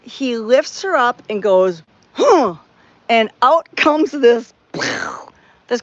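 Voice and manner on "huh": sharp loud effort grunt; heimlich grunt. Retold or imitated. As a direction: imitate the heave of the Heimlich. Firefighter doing the Heimlich.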